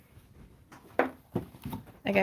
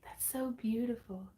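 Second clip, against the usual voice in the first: breathy whispered aside; whispering; 11 dB quieter than usual. Real, not acted. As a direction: whisper it softly. Soft aside about the name.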